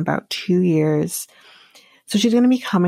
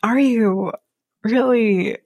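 usual speaking voice

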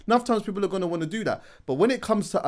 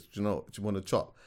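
quiet; 8 semitones lower, 10 dB quieter, faster than usual. Retold or imitated. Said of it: "she says".

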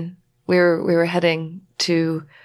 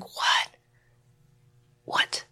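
stunned inner whisper; 6 dB quieter than usual. Retold or imitated. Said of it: "the thing in me ... was like".

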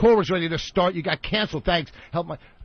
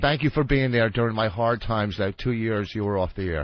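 grudging thanks; 8 semitones lower, 3 dB quieter than usual. Shown as a demonstration. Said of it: "thank me".

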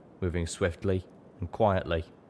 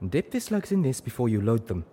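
higher, firm zebra instruction; instructing; 5 semitones higher than usual. A narrator's reading. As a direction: calmly tell her what to do. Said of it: "she instructed".

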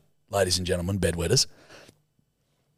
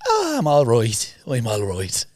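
drawn-out mock Irish reply; put-on Irish accent; slower than usual. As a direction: keep up the pretend Irish act. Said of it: "I had to go along with it".

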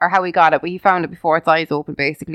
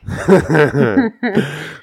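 light laugh. Real, not acted. Laughing at own remark.

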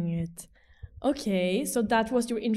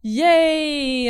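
excited cheer; 5 semitones higher, 9 dB louder than usual. Real, not acted. Cheering to open live podcast.

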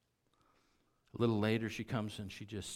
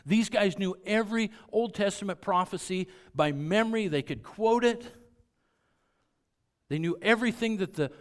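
rising, louder preaching build; 7 semitones higher, 8 dB louder than usual. Acted. Pastor exalting the Gentile woman to the congregation, fervent preaching. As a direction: preach it with growing fire.

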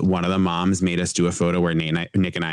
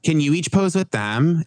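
bossy mom voice; 9 semitones higher than usual. Retold or imitated. Prom mom giving orders.

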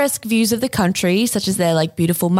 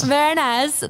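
put-on Borat accent; 6 semitones higher than usual. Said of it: "I just did the accent".

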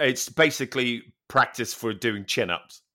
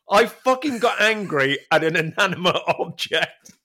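ordinary talk, nothing marked

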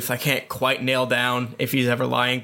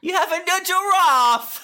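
much higher, louder hammed Cockney exclamation; mock Cockney; 3 dB louder than usual. Player tries a Cockney phrase for laughs, hammed up. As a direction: belt it out like a cartoon Cockney. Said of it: "all the Cockney that I actually know".

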